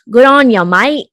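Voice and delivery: over-the-top Australian accent. Shown as a demonstration. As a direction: ham up a mock Aussie greeting. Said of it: "That's my Australian accent".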